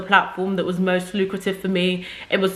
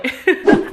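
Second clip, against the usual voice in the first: quick high laugh; a bright little laugh; short laugh; 9 semitones higher, 4 dB louder than usual. Real, not acted. Laughs at own joke.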